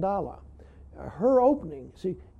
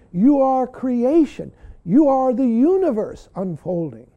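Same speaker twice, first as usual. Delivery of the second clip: emphatic teacher's words; 4 semitones higher, 7 dB louder than usual. Retold or imitated. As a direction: proclaim it like the teacher did. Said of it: "my teacher would say that over and over again".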